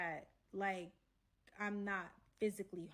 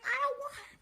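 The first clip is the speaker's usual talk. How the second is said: very high, raised mock tantrum; shrill angry complaint; 5 dB louder than usual. Side girl complaining to her man, mock shrill anger. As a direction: imitate an upset girl protesting.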